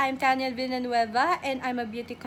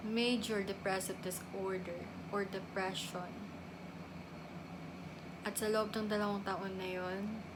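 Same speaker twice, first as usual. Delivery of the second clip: very low, hushed admission; somber confession; 7 semitones lower, 14 dB quieter than usual. Acted. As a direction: confess it softly, with heavy pauses. Confesses her depression diagnosis. (mahina at malungkot na pag-amin)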